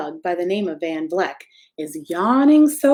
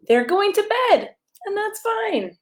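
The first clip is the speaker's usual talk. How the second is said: sing-song; 12 semitones higher than usual. Acted. Sing-song bedtime line.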